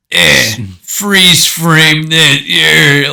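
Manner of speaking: hammed-up action-hero taunt; cheesy one-liner. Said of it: "if you're gonna, like, throw someone".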